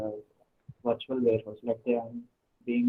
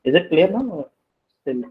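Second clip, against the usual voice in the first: louder, testing the mic; speaking louder; 5 semitones higher, 9 dB louder than usual. Real, not acted. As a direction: raise the voice to be heard. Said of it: "mic is having some issue".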